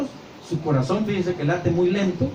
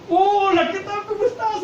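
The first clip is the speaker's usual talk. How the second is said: sugary high greeting, much higher; fake friendly greeting. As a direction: chirp it like a sly line-cutter. Mock line-cutter greeting friend. (saludo falso y muy agudo para colarse en la fila)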